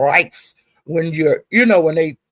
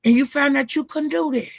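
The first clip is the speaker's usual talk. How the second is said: retold heated callout; 6 semitones higher than usual. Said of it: "And I was saying".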